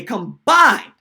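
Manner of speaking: "combined" drawn out and loud; stress on combined. Real, not acted. Host mocking the punter's short kicks, "combined" loudly stressed. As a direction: punch "combined" hard and stretch it. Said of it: "I'm gonna stress that word again".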